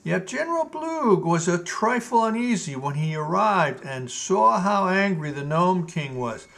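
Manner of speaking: usual speaking voice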